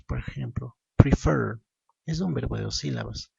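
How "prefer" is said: "prefer" with a loud, punched second syllable; stress on second syllable. Shown as a demonstration. Teacher showing where the stress falls, "prefer" stressed on -FER. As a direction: hit the end of "prefer", preFER. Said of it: "la mayor fuerza de voz".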